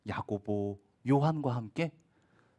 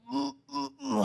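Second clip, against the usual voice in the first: groggy groan; 12 semitones higher, slower than usual. Acted. Lazarus groaning coming out.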